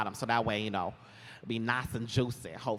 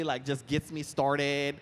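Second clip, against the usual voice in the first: usual speaking voice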